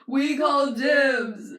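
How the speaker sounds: long, drawn-out loud holler; shout. Acted. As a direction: yell it slowly, as if out a window. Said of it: "You hear them shout out the window".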